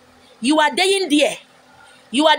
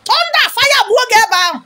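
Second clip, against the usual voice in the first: much higher, loud curse; furious curse; 9 semitones higher, 5 dB louder than usual. Real, not acted. Vlogger cursing an insulting commenter, high loud fury. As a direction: hurl the curse at him.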